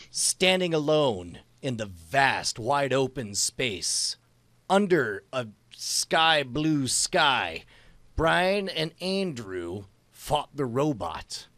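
slow, careful story dictation. Shown as a demonstration. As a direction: lay each phrase down slowly for the app. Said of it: "dictating a story, slowly".

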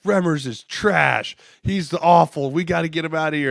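high, loud imitation of fed-up fans; mock fan outrage. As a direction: trash him like an irate fan. Voicing fed-up Carolina fans.

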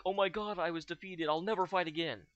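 mocking imitation. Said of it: "So why is he all like".

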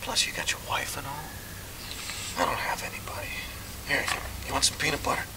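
hushed whisper so nobody wakes. Acted. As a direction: whisper in the dark. Night talk, others asleep.

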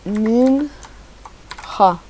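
"Ninja" as a drawn-out NIN... ha; slow typing-along "Ninja", j as a throaty h; read as written. Real, not acted. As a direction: split "Ninja" in two with a Spanish j. Sounds out name while typing.

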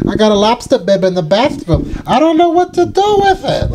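very high Long Island voice from Eight Crazy Nights; Eight Crazy Nights quote in a high Long Island accent. Acted. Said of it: "I'm gonna transition to that".

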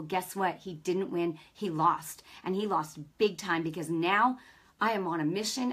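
ordinary talk

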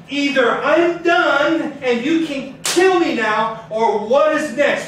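slow, loud pleading from the floor; desperate prayer. Retold or imitated. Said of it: "talking like that".

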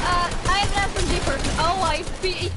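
fast loud excited alert. Real, not acted. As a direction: blurt it out quickly. Sudden enemy encounter.

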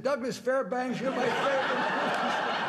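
high, laughing, slowing gush; gushing fan. Retold or imitated. Fan getting the star's name wrong, laughing high gush. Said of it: "he said".

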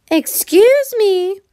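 high, slow hen call. A narrator's reading. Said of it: "she said".

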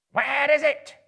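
high, loud goblin cry. A narrator's reading. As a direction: yell it out as a frantic goblin. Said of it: "they cried".

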